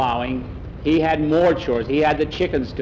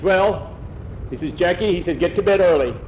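gruff farmer; 5 semitones higher than usual. Acted. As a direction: order him to bed gruffly. Farmer orders boy to bed.

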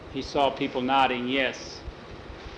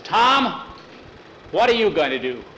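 calling out to Tom, louder, high on the name; yelled; 4 semitones higher, 3 dB louder than usual. Retold or imitated. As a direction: shout the name up to the leader. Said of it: "one of the men yelled up".

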